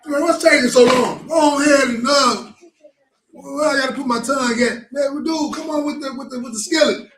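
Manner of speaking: high, loud, rushing impatient shout; a young brother itching to get it over with; fired-up impatient brother. Acted. Youngest brother impatient to face the king, shouting high and eager. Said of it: "he was so fired up".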